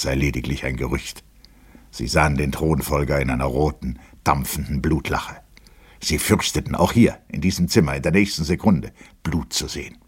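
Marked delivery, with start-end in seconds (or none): none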